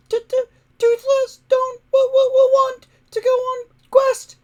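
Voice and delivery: high, stammering dragon voice near tears; squeaky stuttering dragon on the verge of crying; whiny little dragon. A narrator's reading. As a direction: whimper it out like a tiny sulky dragon. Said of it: "he complained".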